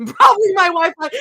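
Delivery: loud laughing yell; laughing shout. Real, not acted. Mock-outraged repeat of chat.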